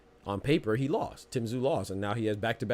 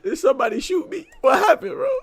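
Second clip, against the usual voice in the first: high, loud quote through laughter; quoting him while cracking up; laughing quote; 7 dB louder than usual. Retold or imitated. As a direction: laugh out the mock question. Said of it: "He said".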